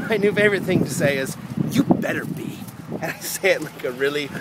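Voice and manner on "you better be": cold menacing whisper; mean, menacing voice. Shown as a demonstration. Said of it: "mean, menacing voice".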